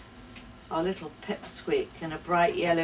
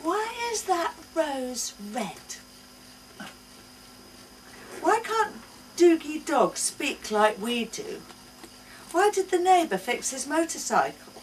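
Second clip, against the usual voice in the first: put-on high child's voice, much higher, louder; curious little girl; 9 semitones higher, 3 dB louder than usual. A narrator's reading. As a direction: ask like an eager curious toddler. Child asking questions.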